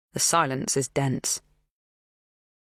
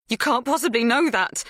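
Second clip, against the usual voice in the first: panicked squeak; 9 semitones higher than usual. A narrator's reading. Narrator's younger self cries out in panic at the drugged food. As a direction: voice jumps up in panic. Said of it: "My voice is a panicked squeak".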